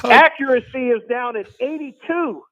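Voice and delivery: high, sing-song Carol Cox voice; the Jets owner suddenly turning into Carol Cox. Acted. Talk show guest slipping into a high Carol Cox voice. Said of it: "has morphed into Carol Cox".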